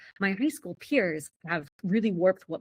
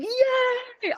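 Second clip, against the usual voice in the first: high, bright mock yay; mock cheer; 4 dB louder than usual. The family cheering while the economy crashes, a high mock cheer. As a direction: cheer it like a kid celebrating.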